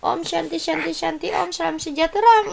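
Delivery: put-on high, soft voice for the baby; whispering over a crib. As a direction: speak for the baby, tiny and soft. Speaking as the baby.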